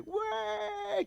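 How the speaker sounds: high-pitched imitation of cheering kids; children's happy whoop, imitated; mock gleeful cheer. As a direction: squeal it like delighted children. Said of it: "girang banget mereka".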